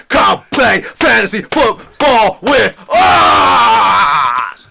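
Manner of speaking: slow, loud, high drawn-out promo shout; wrestling promo voice. Acted. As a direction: stretch it out like a wrestler on the mic. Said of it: "my wrestling voice".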